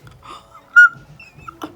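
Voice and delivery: very high gasping squeal of delight; high-pitched weird scream. Real, not acted. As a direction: let out a piercing, breath-sucking squeak. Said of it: "such a high-pitched, weird scream".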